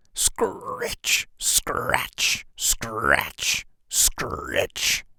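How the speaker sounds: whispered scritch scratch; breathy hoe scraping sounds; scratching noise. A narrator's reading. Narrator imitates hoe noise.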